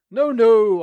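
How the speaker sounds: loud, high refusal; loud insisting no; insistent shout. Acted. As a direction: shout him down. Insisting loudly.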